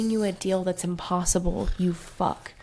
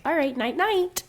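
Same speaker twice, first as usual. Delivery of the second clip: higher, chirpy brush-off; mock dismissive; 8 semitones higher than usual. Heroine brushing off the hero, in a high chirpy voice. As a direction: toss it off lightly, much higher. Mocking Feyre brushing him off.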